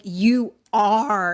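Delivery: loud, emphatic acted retort; losing her mind. Girl turns the child accusation on him, incensed. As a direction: hit both words hard at him. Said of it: "losing her mind".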